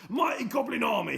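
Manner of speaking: high loud rallying cry; cried out. Acted. Mister Gum calling his goblins, loud and high. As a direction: summon the army. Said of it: "he cried".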